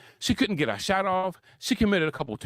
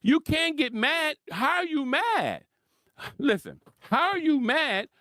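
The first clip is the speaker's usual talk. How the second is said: higher, slow, incredulous; 7 semitones higher, slower than usual. Retold or imitated. Commentator retorting at Kate, slow and incredulous. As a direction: drag out each word in disbelief. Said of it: "I'm like".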